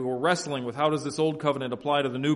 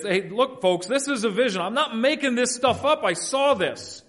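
raised pitch, emphatic protest to the folks; insistent; 7 semitones higher than usual. Acted. Peter defending his vision to folks, insistent. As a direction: press the point, drawing out the last words.

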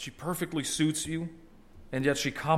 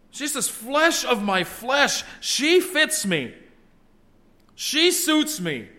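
high, loud, slow exclaiming; emphatic exclamation; 10 semitones higher, 4 dB louder, slower than usual. Acted. Adam exclaiming with joy over his new wife. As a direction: proclaim it with overflowing joy. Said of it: "very emphatic statement".

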